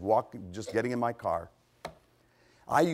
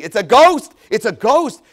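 high, very loud shout of alarm; terrified cry; 9 semitones higher, 17 dB louder than usual. Acted. Disciples see a figure on the sea, terrified shouting. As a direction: cry out in fear at full volume. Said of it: "They cried out in fear".

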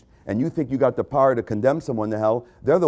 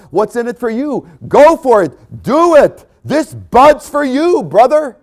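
higher, louder, punchy ad-man hype; mock ad pitch; 9 semitones higher, 7 dB louder than usual. Preacher mocking ads, a loud hammed-up pitch. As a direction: sell it like a beer commercial. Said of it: "look at the advertisements".

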